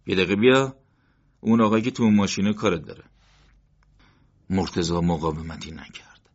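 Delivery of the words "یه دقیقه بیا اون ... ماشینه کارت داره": louder, fast curt order; brusque agent. A narrator's reading. Agent hustling Morteza toward the car, brusque and fast. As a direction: hurry him along, no argument.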